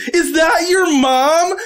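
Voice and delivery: put-on high, slow girl's voice; a lightly voiced eager young woman; excited girl. Girlfriend spotting his mom, high and eager. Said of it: "she just goes".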